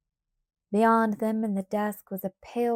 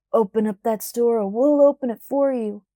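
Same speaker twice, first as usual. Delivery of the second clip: loud threatening shout; a threat hurled at Pa; 7 dB louder than usual. A narrator's reading. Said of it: "came a shout".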